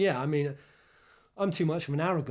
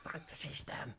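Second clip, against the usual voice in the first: breathy whispered rebel line, much softer; 11 dB quieter than usual. Real, not acted. Echoing his friend, a soft conspiratorial whisper. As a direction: hiss it like a secret slogan.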